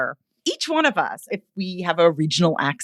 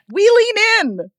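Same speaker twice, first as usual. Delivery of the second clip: high, loud, stretched lean in; excited emphasis; 5 dB louder than usual. Real, not acted. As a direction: lift it with delight. Emphatic point about heroes.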